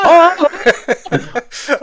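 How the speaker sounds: high, loud hammed-up cry; imitated over-the-top dramatic shout; Matt Berry. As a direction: burst in bellowing like a melodramatic son. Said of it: "his introduction in, um, the I T Crowd".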